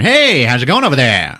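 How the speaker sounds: big, loud, high salesman pitch; smooth-talking salesman. Retold or imitated. Salesman hailing a browsing customer, loud smooth-talking patter. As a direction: boom it out like an overeager car salesman. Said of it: "big old smooth-talking salesman".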